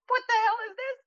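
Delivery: very high-pitched indignant cry; retold outrage. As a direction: squeal it in mock outrage at the cook. Retelling confronting chef in kitchen.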